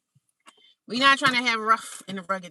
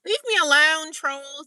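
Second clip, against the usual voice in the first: high-pitched, playful cry at the trolls; put-on wail of protest; mock protest; 10 semitones higher, 5 dB louder, slower than usual. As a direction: call it out high, mock-pleading. Playfully calling out to chat trolls.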